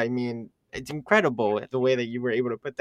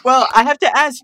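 adrenaline rush; 10 semitones higher, 11 dB louder, slower than usual. Real, not acted. Laughing excited reaction.

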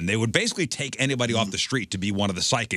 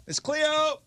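calling out; much slower than usual. Retold or imitated. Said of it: "operators were trained to go".

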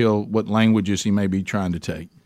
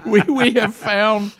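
laughing; 9 semitones higher, slower than usual. Real, not acted. Still laughing after the story.

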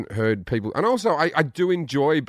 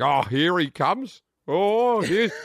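drawn-out, higher teasing of a show-off; mocking relatives; 4 semitones higher, much slower than usual. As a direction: imitate relatives jeering at his arrival. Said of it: "I speak to my relatives and they think".

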